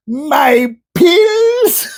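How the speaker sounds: high, drawn-out mock wail; imitated grandpa. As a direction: stretch it out high for deaf ears. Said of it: "just like that. That's how he said it".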